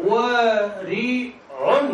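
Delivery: ورع stretched very slowly; drawn-out word. Shown as a demonstration. Teacher to students, stretching ورع slowly. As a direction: hold ورع long for emphasis. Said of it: "ورع يعني إيه ورع؟".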